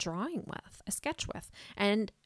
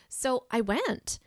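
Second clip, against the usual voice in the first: ordinary delivery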